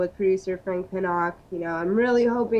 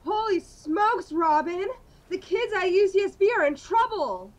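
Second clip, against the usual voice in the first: higher, louder cartoonish superhero dialogue; hammed-up superheroes; 5 semitones higher, 4 dB louder than usual. Batman and Robin shout about rabid squirrels with high excitement. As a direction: ham it up like a campy Batman serial.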